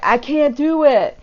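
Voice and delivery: high, put-on whiny voice mocking the heroine; mocking whine. Reviewer mocking the trauma surgeon's panic, whiny high voice. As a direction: whine it like a helpless damsel. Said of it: "And it's like".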